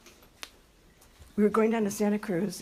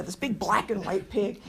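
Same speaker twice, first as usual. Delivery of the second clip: ordinary talk, nothing marked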